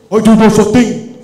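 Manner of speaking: loud, offended protest; indignant denial. Acted. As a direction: act shocked and deny it loudly. Acted denial by the photographer.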